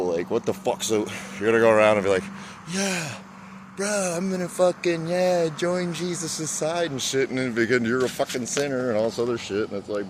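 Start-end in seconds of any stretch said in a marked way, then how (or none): 2.7-7.3 high-pitched imitation of a zealous believer; mock eager convert. Mocking people who go around preaching, mock eager. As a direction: put on a higher, drawn-out recruiter voice.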